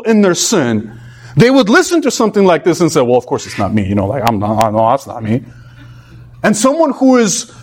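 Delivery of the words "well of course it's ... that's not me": low, offhand mock self-assurance; complacent dismissal. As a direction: brush it off as a complacent listener, low. Voicing complacent listeners brushing off warning.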